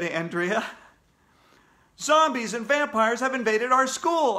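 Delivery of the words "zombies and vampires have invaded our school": loud, high shouted bulletin; shouted alarm. A narrator's reading. AJ warning the school on air, shouting. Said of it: "I shouted".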